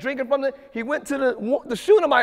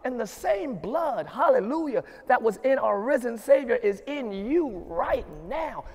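ordinary talk

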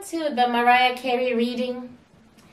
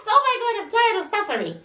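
exasperated outburst; 11 semitones higher, much faster than usual. Real, not acted. Exasperated burst, much higher.

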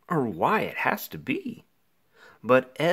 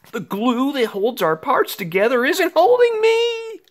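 panicked; 13 semitones higher, 5 dB louder than usual. Acted. Child panics falling apart.

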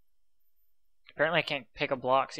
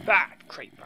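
sharp, higher, loud scold at a bad pet; scolding a naughty pet, now louder; 5 semitones higher, 10 dB louder, slower than usual. Real, not acted. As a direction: tell the creeper off harder. Scolding a mob like a dog.

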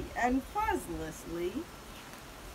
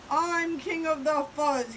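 loud, higher boast; snarled; 4 semitones higher, 5 dB louder than usual. A narrator's reading. Big Bark claiming to be king, snarling. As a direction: snarl it like a bragging bully. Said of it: "he snarled".

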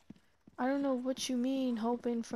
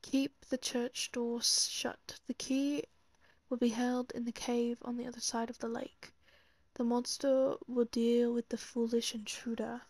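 put-on British accent. Player reading the game's note aloud, put-on British accent. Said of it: "I said that in the British accent".

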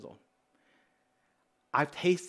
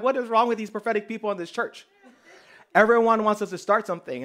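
higher, louder mock exasperation; exasperated complaint; 6 semitones higher, 6 dB louder than usual. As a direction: complain in comic disbelief. Retold exasperation at prophetic church people.

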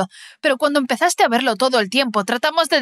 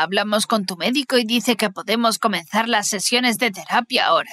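plain reading voice

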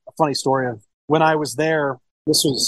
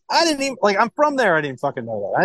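astonished; 8 semitones higher than usual. Retold or imitated. Retold own astonished reaction.

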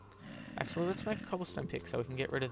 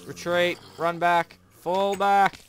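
frantic yell to retreat; panicked shouting; 5 semitones higher, 11 dB louder, slower than usual. Real, not acted. Mob attack, frantic retreat calls.

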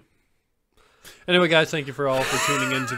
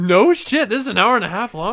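loud, quick disbelief; surprised; 4 semitones higher, 6 dB louder than usual. Real, not acted. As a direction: caught off guard by it. Surprised at length.

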